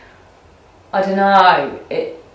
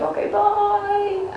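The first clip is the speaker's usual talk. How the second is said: much higher, slow lilting bye; sing-song goodbye; 13 semitones higher, 4 dB quieter, much slower than usual. Retold or imitated. Retelling hugging her nan goodbye, sing-song high. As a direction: sing the farewell lightly, up high. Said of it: "I was like".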